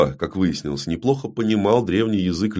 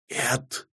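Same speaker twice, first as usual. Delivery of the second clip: faint, high, strangled croak; indistinct dying rasp; 6 semitones higher, 10 dB quieter than usual. Acted. Dying fairy's last word, a faint rasp. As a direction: try to say it, barely getting sound out. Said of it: "но получается невнятный хрип".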